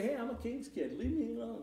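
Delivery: lightly voiced smug king's kid; a hint of a haughty king's child; mock boastful believer. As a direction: toss it off like a spoiled brat. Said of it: "We're not here to say".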